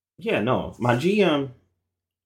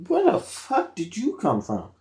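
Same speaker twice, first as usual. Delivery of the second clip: surprised; 10 semitones higher than usual. Real, not acted. Cat suddenly appears in room.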